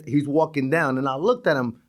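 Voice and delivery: ordinary talk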